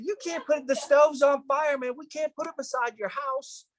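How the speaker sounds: high-pitched imitated objection; retold exasperated refusal, voice way up; mock protest. Acts firefighter's protest in argument.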